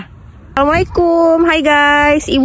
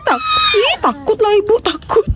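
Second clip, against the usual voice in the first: mock fear; 8 semitones higher than usual. Mother pretends fear of baby.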